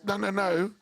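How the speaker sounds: ordinary talk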